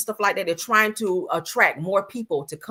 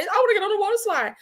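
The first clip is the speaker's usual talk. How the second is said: put-on child voice; 14 semitones higher, faster than usual. Voicing a child wanting slide.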